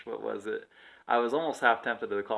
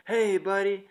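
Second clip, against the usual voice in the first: higher-pitched mock customer voice; drunk customer's heckle; 9 semitones higher than usual. Drunk guest complains to desk clerk about waitress, mock heckling. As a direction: imitate a man calling out to a buddy.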